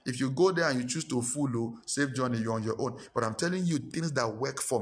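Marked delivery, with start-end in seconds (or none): none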